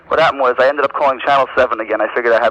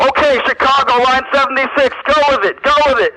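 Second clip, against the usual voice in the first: yelling in the background; 12 semitones higher than usual. Retold or imitated. Prank caller yelling fake newsroom orders to add atmosphere. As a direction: bark orders like a frantic control room. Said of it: "I'm yelling in the background".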